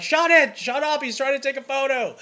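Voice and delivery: family members yelling at a chatty bridesmaid, loud and high; group shouting. Retold or imitated. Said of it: "here are twenty family members who are like".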